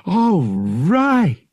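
loud, high, drawn-out cheer; relieved cry. A narrator's reading. Pilot rejoices at having someone to talk to, relieved cry. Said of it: "Han cried".

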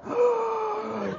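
high sharp gasp; a startled intake of breath; shocked gasp. Retold or imitated. The girls react in shock.

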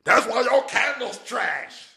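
high loud slow snapping voice; hammed-up angry comeback; mock angry retort. Imitating the person who got mad at the blessing. Quoting someone's angry reply.

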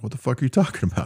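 fast, high mock outburst of disbelief; angry sergeant. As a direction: snap at the private like an irritated first sergeant. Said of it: "I'm gonna talk like them".

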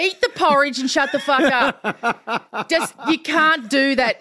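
loud, high, emphatic scolding; exasperated telling-off. Acted. Panellist to a quitting celebrity, exasperated telling-off. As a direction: tell him off, exasperated and loud.

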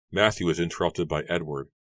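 ordinary delivery

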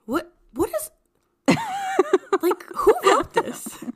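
laughing, high and incredulous; laughing disbelief. Real, not acted. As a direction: laugh through the question.

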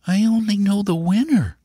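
put-on high, insistent child's voice; small boy stating it firmly, pitched high; high-voiced little nephew; earnest young boy. A narrator's reading.

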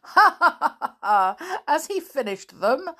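high loud laugh then the question; loud chuckling. Acted. Sammy laughing at Flip, chuckling loudly. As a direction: laugh at the joke you know. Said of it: "chuckling loudly".

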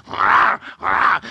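breathy growled rawr; wolf growls. Retold or imitated. Wolves growl in the story, breathy rawr. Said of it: "And the two wolves".

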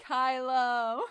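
loud, high hammed-up moan of Kylo; moaned name. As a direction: moan the name over the top. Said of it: "he moaned".

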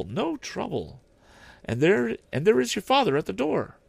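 high, gentle mother holding back grief; mother's voice. Acted. Mrs Cratchit remembering Tiny Tim, then greeting her husband's arrival, high and tender. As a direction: speak lovingly, then brighten at the door.

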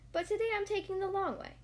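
lightly voiced Pooh, explaining himself. A narrator's reading.

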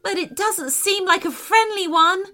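high-pitched worry, no real scream; understated high alarm; mock scream. A narrator's reading. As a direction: worry aloud in a high character voice. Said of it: "screamed Sophia".

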